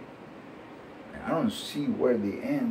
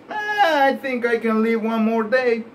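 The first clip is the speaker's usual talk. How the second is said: a hint of a cheerful centenarian, laughing, higher; lightly voiced old man; 10 semitones higher, 4 dB louder than usual. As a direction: lightly play a laughing hundred-year-old. Hundred-year-old man speaking.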